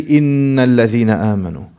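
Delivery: "إِنَّ" with a pressed, held double n; added pressure. Shown as a demonstration. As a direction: lean on the n of "إِنَّ" and run on through the phrase. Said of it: "adding some pressure".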